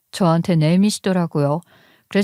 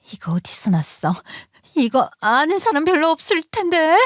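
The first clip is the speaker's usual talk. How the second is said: whispered in shock, then rising high and thin; from hushed fear to a high frightened cry; trembling voice; 8 semitones higher than usual. Retold or imitated. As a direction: caught out, scared and pleading. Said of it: "목소리가 떨리고 있더라고요".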